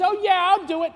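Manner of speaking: high, breezy oh yeah; glib mock agreement. The second son brushing off his father, glib and high. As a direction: toss off an empty promise.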